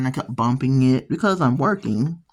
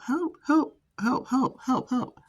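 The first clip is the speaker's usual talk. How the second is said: panicked yelling for help; 10 semitones higher, 4 dB quieter than usual. Retold or imitated. Mock panic, a trainee calling for help after bumping the trainer's arm. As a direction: squeak out a frantic help help. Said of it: "he started yelling for help".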